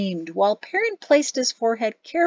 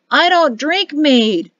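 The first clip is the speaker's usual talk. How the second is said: put-on slow, louder, higher drunken insistence; tipsy protest; 6 semitones higher, 8 dB louder, slower than usual. Tipsy Perrin insists to Gazada, slow drunken protest. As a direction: drag it out like a drunk man objecting.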